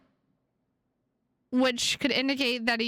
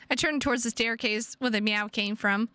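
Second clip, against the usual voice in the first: ordinary delivery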